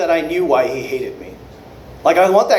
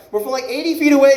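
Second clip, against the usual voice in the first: ordinary talk, nothing marked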